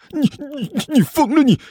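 high, stammering alarm; panicked. Acted. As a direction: sputter in a high flustered voice. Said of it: "那和尚顿时急了".

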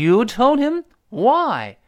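high, loud, drawn-out accusing question; acted outraged betrayed friend; agitated disbelief. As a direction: hit YOU hard, then a loud WHY. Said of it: "第一个人急了".

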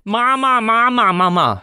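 put-on high, loud child's call for mother; a child's high, loud cry of "妈妈"; calling mama loudly. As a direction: shout for mama across the house. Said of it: "大声地喊道".

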